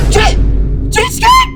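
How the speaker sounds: shrieking a name in anger; angry shout. Acted. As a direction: yell it high and furious. Girlfriend yells name in anger.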